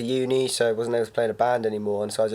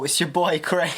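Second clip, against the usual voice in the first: hip-hop shout-out; 5 semitones higher than usual. Pretend. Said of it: "early two thousands hip-hop".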